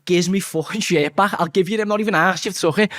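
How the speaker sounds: pleading. Retold or imitated. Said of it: "turning around to the crowd going".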